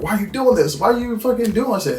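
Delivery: high-pitched exasperated imitation; protesting. Louis challenging Lestat over the killing, protesting. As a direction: question him in mock dismay, pitch up.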